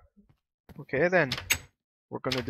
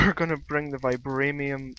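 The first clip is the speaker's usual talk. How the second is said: laughing; 8 dB louder than usual. Real, not acted. Laughs after herpaderp slip.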